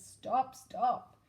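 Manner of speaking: knight shouting. Acted. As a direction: knight bellows at the king. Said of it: "shouted the knight".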